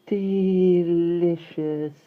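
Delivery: put-on high, very slow blissful baby; happy sigh. A narrator's reading. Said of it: "said Barbara with a happy sigh".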